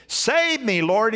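high, loud cry for help; shout. A narrator's reading. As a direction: yell it like you're sinking. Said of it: "he shouted".

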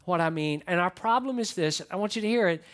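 usual speaking voice

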